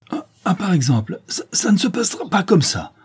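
high, spluttering outrage; stammering with fury. Acted. Béchou on seeing his torn warrant, stammering furiously. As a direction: trip over the first words, then burst out. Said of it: "Béchou bégayait de fureur".

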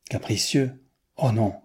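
ordinary delivery

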